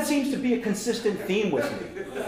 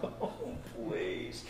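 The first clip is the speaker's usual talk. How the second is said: exasperated sigh; 11 semitones lower, 16 dB quieter than usual. Retold or imitated. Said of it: "I'm like".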